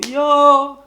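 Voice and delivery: ordinary talk, nothing marked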